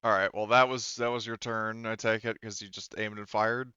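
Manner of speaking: ordinary talk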